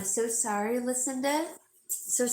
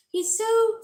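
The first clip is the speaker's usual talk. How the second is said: high, loud calling for a pet's attention; 8 dB louder than usual. Real, not acted. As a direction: call the dog over, high and eager. About spoiled dog.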